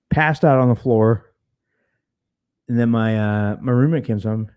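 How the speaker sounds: normal talking voice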